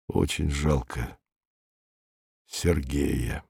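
slow, low and soft. A narrator's reading. He regrets his lost friend Sergei, slow and low. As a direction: draw it out quietly, with a pause before the name. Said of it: "медленно сказал он".

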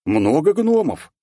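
high, slow exclamation; feigned surprise. Acted. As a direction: exclaim in drawn-out mock amazement. Man mimicking surprise at dwarves.

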